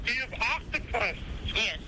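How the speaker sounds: normal talking voice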